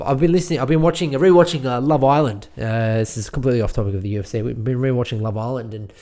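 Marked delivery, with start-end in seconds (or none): none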